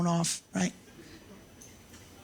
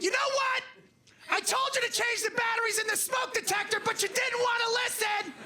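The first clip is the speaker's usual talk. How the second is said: high, shouted scolding; nagging wife; 14 semitones higher than usual. Retold or imitated. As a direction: shriek the blame at him. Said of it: "You're like".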